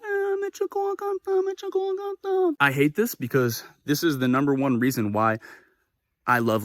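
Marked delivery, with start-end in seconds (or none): 0.0-2.5 extremely high squeaky voice; imitated girlfriend. Girlfriend reacting after the confrontation, very high mock squeal.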